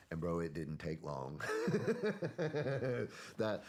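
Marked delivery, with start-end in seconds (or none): 1.4-3.3 high, bright laugh. Real, not acted. Telling his friend the dose hit fast, he laughs.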